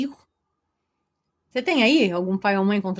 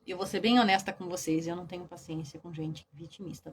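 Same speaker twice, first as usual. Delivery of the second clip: ordinary talk, nothing marked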